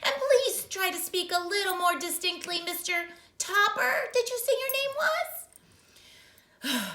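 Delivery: high, loud prim clerk; clerk on the phone. Acted. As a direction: sound like a fussy official.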